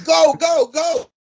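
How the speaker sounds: high, loud cheering "go"; egging on. Retold or imitated. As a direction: whoop him on like a race. Whacking him, urging him on.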